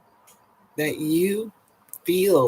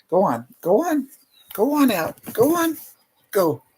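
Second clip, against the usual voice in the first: high, bright coaxing to a dog, louder; 11 semitones higher, 4 dB louder than usual. Real, not acted. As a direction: shoo the dog out, much higher and louder. Talking to her dog Goldie.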